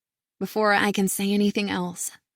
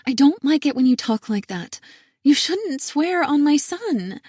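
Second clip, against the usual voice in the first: upset sister; 6 semitones higher, 5 dB louder than usual. A narrator's reading.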